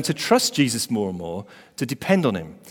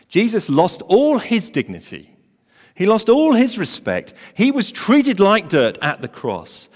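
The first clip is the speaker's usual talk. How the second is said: higher, louder, slow preaching climax; full-force preaching; 9 semitones higher, 6 dB louder, slower than usual. Acted. Preacher telling the church how Jesus was shamed, loud and high. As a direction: drive home Christ's humiliation.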